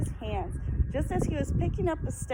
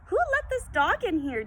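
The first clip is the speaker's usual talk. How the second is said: demanding; 6 semitones higher than usual. A narrator's reading. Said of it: "demanded the manager".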